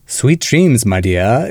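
Mid-Atlantic accent, the r dropped in "dear"; old-movie transatlantic accent, "dear" as "dee-uh"; Vincent Price. Shown as a demonstration. Host showing how old actors talk, Mid-Atlantic accent. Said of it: "Vincent Price would say".

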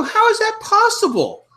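very high, slow strained voice; mock pained squeal. Caught in a Marine's vice grip handshake, high pained squeal. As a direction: squeeze it out high, as if in pain.

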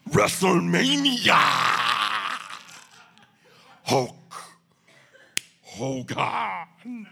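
Ultimate Warrior shouting promo; bellowed intense wrestler promo; wrestling promo. Retold or imitated. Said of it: "one hundred percent accurate reenactment".

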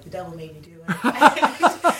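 loud, slow, laughing excuse; laughing mock excuse. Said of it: "was like".

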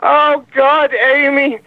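high-pitched, hammed-up melodrama; mock desperate plea. Caller urging Amy to run, mock desperate plea.

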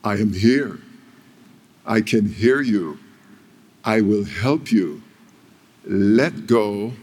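a lightly voiced, calm divine voice; a hint of God answering the climber, calm and steady. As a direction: speak as God, slow and unhurried. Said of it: "baritone voice of God".

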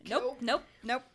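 gasp, then loud alarmed nopes; spooked. Real, not acted. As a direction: recoil at the creepy image. Gasp at scary witch story.

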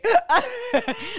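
high, loud laughter. Real, not acted. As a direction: crack up at the joke. Laughing at hair joke.

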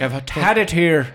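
angry Irish boss voice; fed up, in an Irish lilt; Irish accent. Acted. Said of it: "Do it in an Irish accent".

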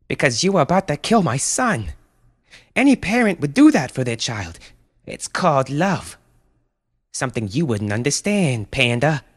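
high, impassioned rebuke; indignant protective mother. Acted. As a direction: throw it defiantly at the beast.

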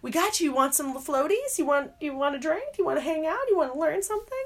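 high, bubbly mock welcome; eager welcome. Knitters welcome a newcomer with high bubbly eagerness. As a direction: offer everything at once, delighted to help. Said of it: "And here they're like".

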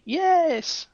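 a sudden high eager yes; client's high yes. Retold or imitated. Hypnotized client eagerly answering the therapist, high yes.

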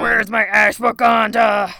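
hammed-up yell for a missing herb; mock outraged shout. Cohost butts in, voicing Lottie's angry demand.

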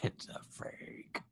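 slow, soft, voiceless mock cry; hushed, drawn-out meme imitation; imitating weird emotion. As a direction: stretch it out in a strained whisper. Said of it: "It's a weird emotion that he's on".

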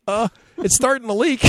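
put-on boy reports the boat leaking; kid's voice. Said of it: "and Johnny's like".

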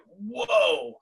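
sudden high startled whoa; surprised whoa. Retold or imitated. Customer seeing the bill, startled whoa. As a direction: caught off guard by the bill. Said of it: "And you're like".

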